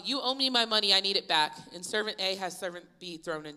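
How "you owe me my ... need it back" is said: loud, raised-pitch demand for payment; acted forceful insistence. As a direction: press hard like an impatient creditor. Acting servant demanding his money back.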